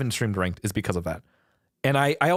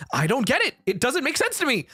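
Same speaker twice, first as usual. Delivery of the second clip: high-pitched, baffled insistence; exasperated protest; 10 semitones higher than usual. Real, not acted. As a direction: protest that you are truly lost. Baffled streamer protests to chat.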